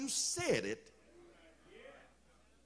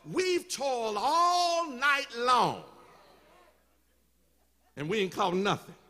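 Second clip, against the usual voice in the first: weary loud protest; 2 semitones higher, 4 dB louder than usual. Acted. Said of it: "He said".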